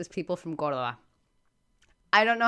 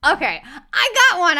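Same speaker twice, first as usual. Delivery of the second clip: higher and louder, thrilled; excited; 7 semitones higher, 7 dB louder than usual. Real, not acted. Player thrilled at solving her first clue, excited. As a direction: announce your win with real glee.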